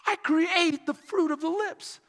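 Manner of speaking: "I create the fruit of the lips" in one high, connected phrase; flowing repeat. A narrator's reading. Preacher repeating Isaiah's words to the church, high and flowing.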